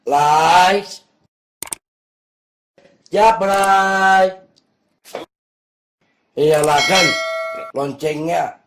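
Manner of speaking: shouted channel plug; loud vlog call-out. Real, not acted. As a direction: belt the subscribe plea. Calls out channel plea loudly.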